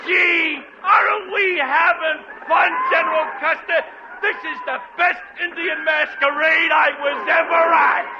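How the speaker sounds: thrilled, clueless cheering; gleeful. Acted. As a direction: crow over the prank. Jenkins gleeful, oblivious.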